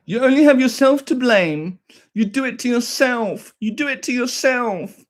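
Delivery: high, slow sneering parody; mock commenter. Streamer mocking a commenter who blames him, high slow parody. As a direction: drag it out like a smug troll.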